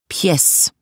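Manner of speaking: whispered, anguished word; a pained, whispered answer; in torment. A narrator's reading. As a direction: breathe it out as if in agony. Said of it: "odrzekła mi z niezmierną męką".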